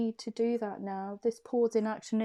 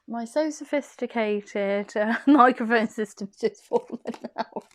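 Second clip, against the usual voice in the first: louder, laughing self-mockery; laughing apology; 2 semitones higher, 10 dB louder than usual. Real, not acted. Microphone falls mid-reading, laughing apology. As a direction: laugh it off as the mic drops.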